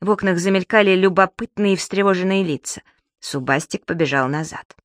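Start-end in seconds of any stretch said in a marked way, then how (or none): none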